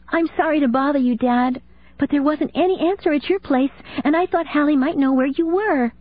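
put-on high, small girl's voice, worried; a child calling her dad for help; young daughter on the phone. Daughter phoning her dad, high young girl's voice.

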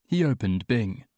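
plain reading voice

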